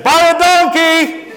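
high mock yell out the window; hammed-up roadside yell; yelled insult. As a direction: shout it at the slow drivers, laughing. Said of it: "and I'd say".

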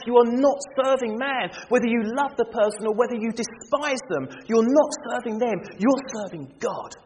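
high, loud, driving exhortation; preaching at full force. Acted. Preacher urging the congregation to serve God, ringing and loud. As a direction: press the point home on the congregation.

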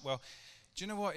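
ordinary talk, nothing marked

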